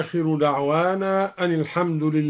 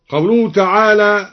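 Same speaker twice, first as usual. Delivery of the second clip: ordinary delivery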